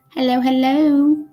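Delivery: normal talking voice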